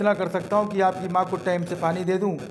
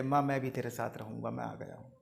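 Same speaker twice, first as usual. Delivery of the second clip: tearful plea; 5 semitones lower, 11 dB quieter than usual. Acted. Judge at his mother's feet, softly weeping. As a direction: lower and much softer, close to tears. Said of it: "पकड़ कर रोने लगे".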